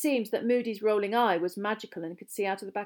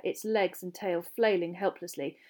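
ordinary delivery

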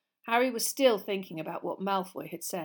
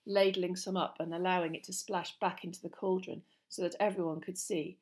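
ordinary delivery

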